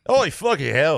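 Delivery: mock a burly British manager's accent. Host repeats his British impression for his co-host, mock British yell. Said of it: "You did that British accent pretty good".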